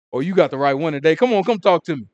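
retold excited call to come talk; eager invitation.

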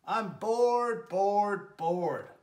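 "bored" repeated loud then fading down; bored bear whining. Acted. Bear whining to his friends, "bored" three times fading. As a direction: whine "bored" three times, each one falling off. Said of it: "roars Bear".

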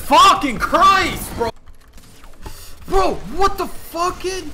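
high, loud furious outburst; raging gamer. Real, not acted. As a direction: blow up at the screen. Gamer explodes at the game.

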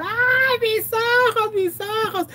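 high wailing over burning eyes; mock cry of pain. Retelling sprayed drunks writhing, high mock wail. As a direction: ham up a man writhing from the gas. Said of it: "los viejos empiezan a revolcar".

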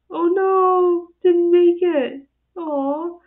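high, loud, drawn-out oh no; loud sympathetic groan; dismayed. Real, not acted. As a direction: sound let down for the dog. Dog fails jump on video.

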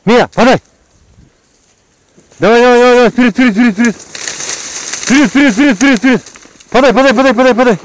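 high, loud urging calls; excited dog calling. Real, not acted.

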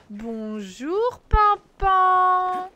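high, loud drawn-out "Pampan"; drawn-out, loud greeting to Pampan; called-out greeting. Pretend. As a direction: sing out the name to the chat. Leads chat greeting, calling out.

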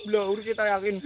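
ordinary talk, nothing marked

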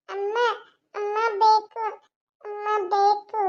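put-on high toddler whine; crying little girl. Child left with the maid wanting mother, high whine. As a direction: whimper for mother in a small child's voice. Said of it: "ಅಳ್ಬೇಡಮ್ಮ, ಅಳ್ಬೇಡ".

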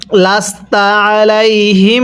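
measured chant, আলাইহিম ending on a long nasal m; recite, then let আলাইহিম ring in the nose; ghunnah recitation. Shown as a demonstration. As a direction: hold the final m of আলাইহিম as a hum. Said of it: "করে পড়তে হয়".

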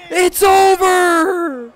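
very high, loud, slow yell; drawn-out victory shout. Real, not acted. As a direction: stretch the win into a roar. Celebrating the win.

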